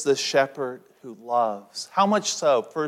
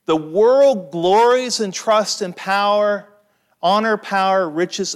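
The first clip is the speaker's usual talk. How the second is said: preaching at full force; 5 semitones higher, 6 dB louder than usual. Acted. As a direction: declaim it to the congregation. Preacher opens with quoted text.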